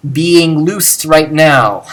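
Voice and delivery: "being loosed right now" slow and loud, each word weighted; emphatic translation. Shown as a demonstration. Teacher stressing it's going on right now, emphatic.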